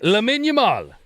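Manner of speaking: Lamine Yamal with a French lilt, yah-MAHL; French accent. Retold or imitated. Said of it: "Why is it in a French accent?".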